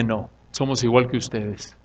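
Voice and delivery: low, soft, understated reply; humble refusal. Acted. Preacher voices Paul refusing worship, soft and humble. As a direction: quietly turn down the honour.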